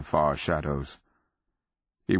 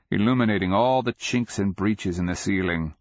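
usual speaking voice

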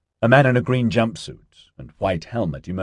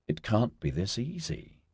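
muttered; 3 semitones higher, 11 dB quieter than usual. A narrator's reading. A woman doubting it is this easy, muttered. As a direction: mutter it under your breath. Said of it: "she muttered".

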